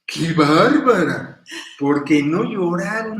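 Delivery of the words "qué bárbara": much louder, slow, admiring outburst; amazed exclamation. Retold or imitated. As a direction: marvel at her out loud, slowly. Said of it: "Pero yo decía". (exclamación admirada, fuerte y lenta)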